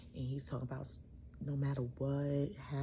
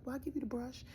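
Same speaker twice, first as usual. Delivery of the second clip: bright, much higher coaxing offer; 11 semitones higher than usual. Retold or imitated. Daughter coaxing her confused dad to get ready, high and sweet. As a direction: humor him the way you would a child.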